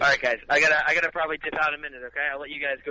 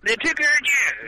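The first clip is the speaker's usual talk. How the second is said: high, slow yokel holler; 10 semitones higher, 4 dB louder, much slower than usual. Pretend. Echoed cartoon chant.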